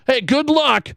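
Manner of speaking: normal talking voice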